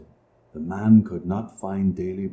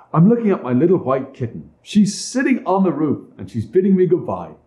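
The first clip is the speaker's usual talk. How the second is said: put-on childlike boy; 6 semitones higher, 3 dB louder than usual. A narrator's reading. Child answers.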